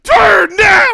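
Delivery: high, loud urgent command; screaming. Retold or imitated. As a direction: scream the order at the driver. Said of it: "I'm screaming at him".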